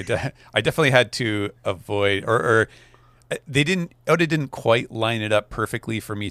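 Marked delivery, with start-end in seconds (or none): none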